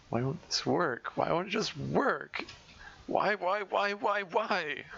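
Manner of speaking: rising, breaking voice, near sobbing; anguished repeated why, voice cracking upward; desperate crying wail. Acted. Monica crying, hitting head.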